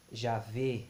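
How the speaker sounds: "Javé" drawn out slowly as Ja-vê, with closed final E; long closed E. Shown as a demonstration. Teacher correcting the final vowel, slow closed-E "Javé". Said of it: "mais longo".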